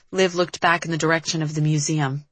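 usual speaking voice

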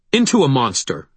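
high, loud angry retort; snapped. A narrator's reading. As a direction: snap it back at him. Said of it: "I snapped".

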